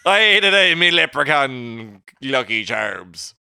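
put-on high shouted, then slow, stage Irish leprechaun accent. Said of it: "too Irish".

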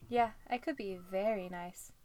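lightly voiced flirty tease; a hint of a flirt. A narrator's reading. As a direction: play it coy and flirty. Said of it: "Hazza said in a flirty voice".

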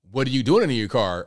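usual speaking voice

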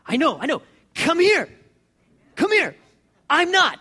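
high, loud, brushing off objections; excited high-pitched summons; insistent beckoning. Acted. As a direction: call them over, cutting through excuses. Jesus waving off objections, beckoning.

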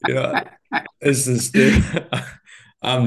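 laughing through the words; chuckling it out, slowed; amused, half laughing as he talks. Real, not acted. Laughing mid-sentence on a podcast.

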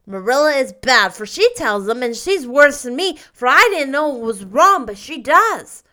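put-on loud, certain child; decided. A narrator's reading. Davy accuses Marilla of lying, loud and decided. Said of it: "said Davy decidedly".

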